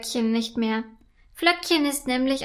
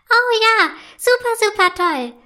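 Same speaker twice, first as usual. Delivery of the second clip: much higher, louder squeals of joy; thrilled little fairy; overjoyed; 9 semitones higher, 6 dB louder than usual. A narrator's reading. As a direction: ice elf cheering and spinning around. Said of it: "freut sich die Eiselfe".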